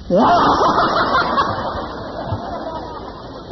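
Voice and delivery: high burst of laughter; big laugh. Real, not acted. Preacher laughs at the visitor's surprise, high burst.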